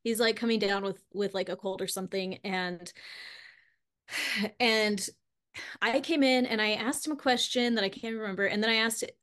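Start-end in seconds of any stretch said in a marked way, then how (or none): none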